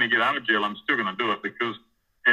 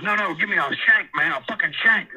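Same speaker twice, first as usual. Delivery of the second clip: mildly agitated retold demand, pitched up; 7 semitones higher, 4 dB louder than usual. Preacher demanding a shank from his cellmate, agitated. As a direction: imitate someone urgently demanding, a little raised.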